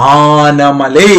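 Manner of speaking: ആനമലേ stretched very slow with a rising end; drawn-out memory cue. Shown as a demonstration. Teacher drilling students on a place name, ആനമലേ drawn out slowly. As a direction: hold every syllable of ആനമലേ long, then lift it. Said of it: "Anamala എന്ന് കേൾക്കുമ്പം".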